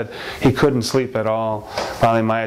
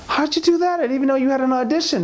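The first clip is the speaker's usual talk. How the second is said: very high, astonished wife; high-pitched quick wonderment; amazed questioning. Acted. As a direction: react in excited disbelief, pitched way up. Wife amazed by husband's news.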